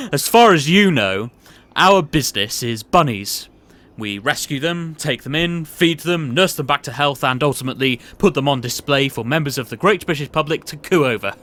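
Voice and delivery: chuckling, patronising boss; jovial boss. Acted. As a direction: laugh him off then lean in to confide. Boss reassures, then confides.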